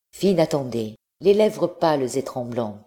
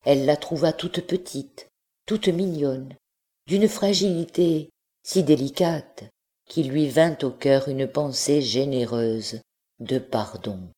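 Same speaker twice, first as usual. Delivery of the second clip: ordinary delivery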